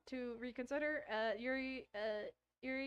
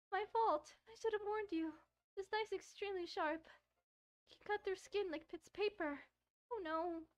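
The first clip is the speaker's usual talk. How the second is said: put-on higher, shy girl's voice; shy Yuri; 10 semitones higher than usual. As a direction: play the bashful knife lover.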